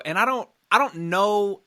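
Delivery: ordinary delivery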